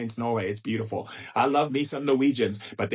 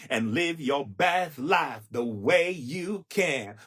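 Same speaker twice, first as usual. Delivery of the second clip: slow drawn exhortation; slower than usual. Real, not acted.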